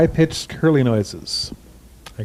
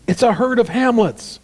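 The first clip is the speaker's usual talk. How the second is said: performed exclamation; 8 semitones higher than usual. Acted. Performed riff line.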